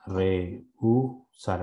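"Rehusar" dicho despacio, separado en sílabas con pausas. Shown as a demonstration. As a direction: di "Rehusar" muy lento, sílaba a sílaba. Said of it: "cómo sería la separación ahí". ("Rehusar" separado por sílabas, con pausas claras)

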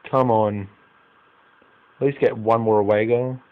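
ordinary talk

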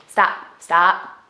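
loud, firm stop to the dog; firm dog command. Real, not acted. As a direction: tell the dog off sharply.

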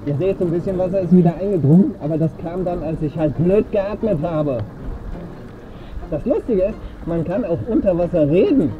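muffled voice inside a mask; talking through snorkel mask. Real, not acted. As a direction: talk from inside a full-face mask. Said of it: "Ich bin ein bisschen verängstigt, wenn du so mit mir redest".

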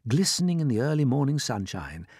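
usual speaking voice

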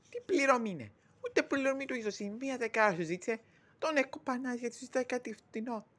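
high-pitched, indignant protest; indignant retort. Acted. Challenging the boss over unpaid wages, indignantly.

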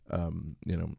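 normal talking voice

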